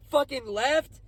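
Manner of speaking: ordinary delivery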